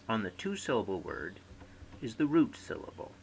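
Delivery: ordinary delivery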